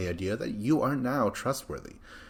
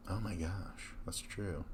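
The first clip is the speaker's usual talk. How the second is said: very soft, whispered realisation; whispered aside; 11 dB quieter than usual. Real, not acted. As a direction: drop to a whisper, as if to yourself.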